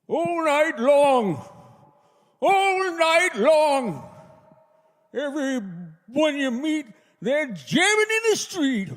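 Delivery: put-on high, slow, crotchety old man singing; grumpy old man voice. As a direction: croak it out like a cranky grandpa. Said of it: "if the narrator was a grumpy old man".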